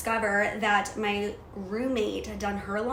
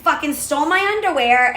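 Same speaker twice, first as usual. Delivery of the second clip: higher, louder outraged tirade; indignant fury, pitched up and loud; retold paranoid rant; 5 semitones higher, 6 dB louder than usual. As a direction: rant like you've caught the thieves red-handed. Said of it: "I was a psycho fucking mindset".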